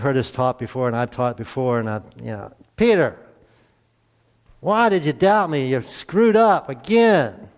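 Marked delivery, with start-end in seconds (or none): 2.8-7.5 loud, high, slow scolding of Peter; harsh scolding. Retold or imitated. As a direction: scold him like a fed-up master. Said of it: "that's not the attitude".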